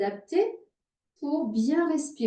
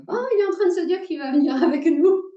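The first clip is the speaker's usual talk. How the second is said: high, loud, quick burst into laughter; amused teasing; 8 semitones higher, 7 dB louder, faster than usual. Real, not acted. Teacher teasing a student about joining in, amused. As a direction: tease brightly, tipping over into laughing.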